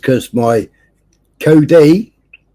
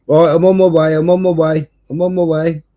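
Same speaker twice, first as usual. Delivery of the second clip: higher, hurried repeated promise; mate rushing off; 5 semitones higher than usual. Retold or imitated. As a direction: repeat it like he's already running.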